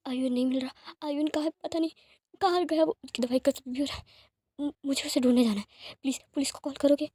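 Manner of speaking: frantic, rushed pleading; a worried father searching for his son, panicking; panicked. A narrator's reading. Wing begs Lanjun to call police, panicked. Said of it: "wing को panic देखकर".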